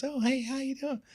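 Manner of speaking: very high, soft-spoken falsetto greeting; light, high-pitched celebrity impression. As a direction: imitate a star in a tiny high voice. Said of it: "and there's [name] right there".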